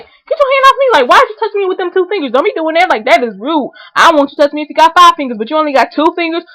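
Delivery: imagined telling-off. Pretend. Pushed-off woman snapping at the lady, high and indignant. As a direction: snap at the lady to get off.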